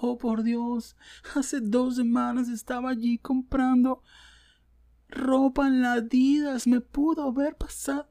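high, slow melodramatic mock voice; mock dramatic poster. Mocking a self-centred poster, hammed-up dismay. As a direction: ham up the shocked online poster. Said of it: "Es una mierda en el Dolphin Mall".

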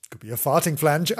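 plain reading voice

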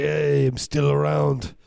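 a hint of a breezy movie star; lightly voiced Arnold. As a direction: play him shrugging it off, easygoing. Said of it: "he's just like".

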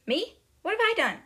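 high, loud, startled question; innocent protest. Retold or imitated. Matilda told to stand up, startled innocent protest. Girl protests being singled out.

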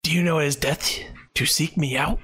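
fast, raspy spectral whisper; whispered ghost. Acted. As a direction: breathe it like a threatening spirit. GM voices the ghost.

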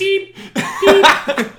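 very high, loud comic beeping; robot beeps. Acted. Playing the supercomputer brother, high silly robot beeps. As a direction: squeal out mock computer beeps.

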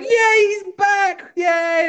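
high, drawn-out mock cheer; hammed-up high celebratory shout; overjoyed. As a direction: cheer loudly like a delighted crowd.

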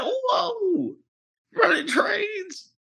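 very high, drawn-out disbelief; mock shock. Boyfriend hearing girlfriend's confession, mock shock. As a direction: squeal it as a retold double-take.

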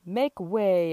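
loud limousine call; loud make way; yelled. Acted. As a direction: clear the road with a shout. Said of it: "yelled the limousine".

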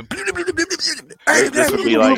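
squeaky high excited voice; high squeal. Acted. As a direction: shriek it out high and fast. Host squeals about fast match.

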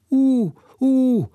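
high, loud hooting owl call. Acted. Owl Jodocus hooting from the castle tower, loud. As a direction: hoot like an owl into the dark. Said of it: "riep Jodocus".

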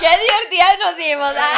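loud, high laughing line; giggling through the words. Real, not acted. Friends crack up mid-story, laughing loudly. Laughing banter about crying.

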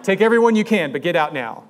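loud, urgent warning to flee; yelling at the class to run; mock alarmed shout. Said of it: "I'd be like".

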